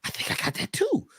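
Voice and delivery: mock whisper. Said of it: "I says".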